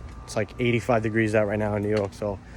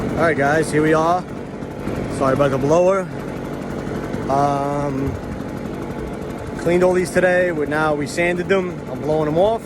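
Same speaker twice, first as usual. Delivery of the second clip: yelling over leaf blower; 3 semitones higher than usual. Real, not acted. Sorry about the blower.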